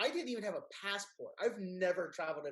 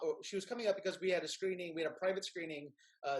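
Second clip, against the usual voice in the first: ordinary talk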